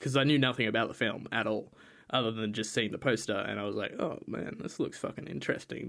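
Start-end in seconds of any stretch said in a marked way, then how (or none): 4.0-5.9 soft, half-whispered retold reaction; quietly impressed aside to yourself; hushed intrigue. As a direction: say it under your breath, intrigued. Retells first reaction to the poster.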